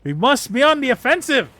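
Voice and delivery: exclaiming guard. Acted. Guard reads his orders and bursts out, shouting high. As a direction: blast it out like a startled old soldier. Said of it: "before exclaiming".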